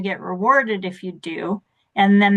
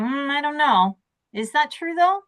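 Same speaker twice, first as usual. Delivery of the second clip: doubtful questioning; 6 semitones higher than usual. Acted. Voicing someone challenging a negative thought.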